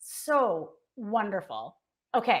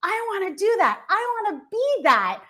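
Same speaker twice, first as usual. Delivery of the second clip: put-on excited kid; 7 semitones higher than usual. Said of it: "You're like".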